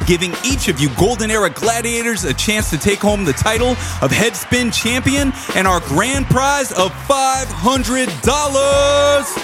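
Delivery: big game show host voice, building; booming prize announcer. Acted. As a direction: sell the grand prize, rising to the end.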